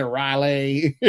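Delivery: Raleigh garbled into uh-RYE-lay; mangled Raleigh. Retold or imitated. Imitating a football player mangling Raleigh, uh-RYE-lay. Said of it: "He couldn't say it".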